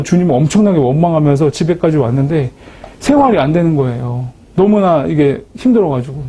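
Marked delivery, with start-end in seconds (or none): none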